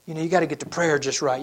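ordinary talk, nothing marked

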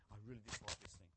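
ordinary talk, nothing marked

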